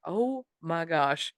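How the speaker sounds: slow, soft exasperated groan of words; annoyed sigh. Acted. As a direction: drag it out, quietly fed up. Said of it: "it's just really annoying".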